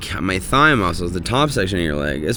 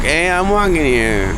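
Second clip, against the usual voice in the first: put-on slow, higher New York cabbie accent; 9 semitones higher, 3 dB louder than usual. Just woke up in New York, New York accent. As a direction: do the New York "I'm walking here" bit.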